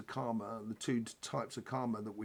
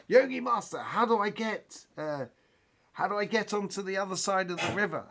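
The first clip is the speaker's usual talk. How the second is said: eager student calling out; 8 semitones higher, 4 dB louder than usual. Acted. Student shouts across the river to his master, high and eager. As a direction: call over the water like a keen pupil. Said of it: "his student calls over to his yogi master and says".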